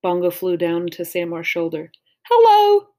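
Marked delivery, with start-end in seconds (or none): 2.3-2.9 put-on much higher and louder bird copying a girl; a crow parroting a girl's greeting, pitched way up and loud; mimicking a girl's voice. A narrator's reading. Crow mimics Samar greeting Stephen, high, loud copy. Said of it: "mimicking Samar's voice".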